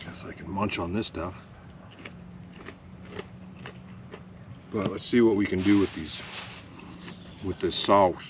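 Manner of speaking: talking through a mouthful; words round a bite of food; mouth full. Real, not acted. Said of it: "I'm talking with my mouth full".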